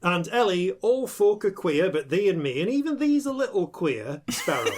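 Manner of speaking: a hammed-up West Country yokel saying; West Country accent. Host greeting a player in a light West Country accent. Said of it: "I've gone to the West Country".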